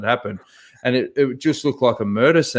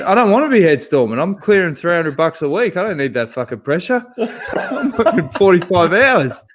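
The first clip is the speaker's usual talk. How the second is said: fast, higher, louder indignant rant breaking into a laugh; indignant inner voice; 6 semitones higher, 3 dB louder than usual. Retold or imitated. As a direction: rant in your head, getting louder then laughing. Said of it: "I'm thinking".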